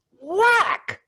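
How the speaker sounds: whack. Retold or imitated. Kid swats the dog.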